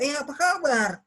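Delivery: high, animated hey-how-are-you; enthusiastic, high-pitched greeting; greeting given life. Shown as a demonstration. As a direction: swoop up brightly on kabar, then fall. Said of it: "Itu sudah beda".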